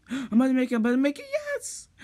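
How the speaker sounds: high, loud rising excitement ending in a squeal; shrieking jackpot winner. Real, not acted. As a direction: build to a victorious shriek. About to finish the obby.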